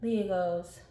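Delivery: ordinary delivery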